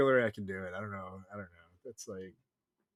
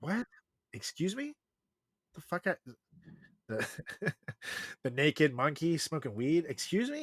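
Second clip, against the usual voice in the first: mock disbelief; 5 semitones higher, 4 dB quieter than usual. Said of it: "and I'm like".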